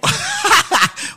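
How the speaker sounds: high whooping burst of laughter. Real, not acted. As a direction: let out a big, high laugh.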